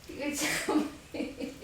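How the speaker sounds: slow, airy laughing words; nervous laugh. Real, not acted. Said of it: "This is like a nervous laugh".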